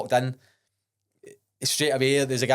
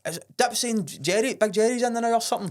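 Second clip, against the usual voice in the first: mock friend voice; 10 semitones higher than usual. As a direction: draw it out high like a surprised pal. Friend Jagger quoted, teasing.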